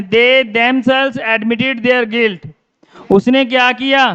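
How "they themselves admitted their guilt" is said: each word slow and separate, dictated to the class; slow dictation. Shown as a demonstration. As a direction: read the sentence out very slowly, word by word. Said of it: "कि जैसे ये लिखा मैंने". (कक्षा को बहुत धीरे-धीरे लिखवाते हुए)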